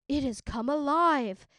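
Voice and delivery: startled magistrate gasps at the living dragon, shocked and loud; gasping in fright. A narrator's reading. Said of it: "I heard him gasp".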